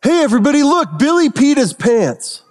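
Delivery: high mock-taunting kid voice. Kid on the field trip taunts Billy, in a high mock jeer. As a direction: jeer like a schoolyard brat. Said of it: "and a kid goes".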